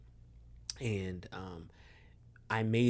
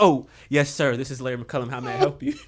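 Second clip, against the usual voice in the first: loud, higher, suddenly proper phone manner; hastily composed politeness; 4 semitones higher, 11 dB louder than usual. Retold or imitated. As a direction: imitate snapping to attention for the coach. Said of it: "I got it together so quick".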